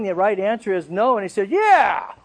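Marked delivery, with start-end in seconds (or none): 1.5-2.1 Jonah snaps back a high, loud yes; defiant yell. Retold or imitated. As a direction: shout it back at God, stubborn and defiant. Jonah defiantly answers God.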